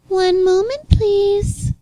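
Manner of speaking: high, sing-song customer-service politeness; imitated polite drive-thru worker, drawn out; mock polite voice. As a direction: put on an overly polite high voice. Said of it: "In a polite voice".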